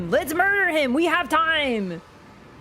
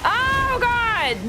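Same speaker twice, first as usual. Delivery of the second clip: excited yell; 10 semitones higher, slower than usual. Real, not acted. Streamer yells in alarm as the boss enrages. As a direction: yell as the boss goes wild.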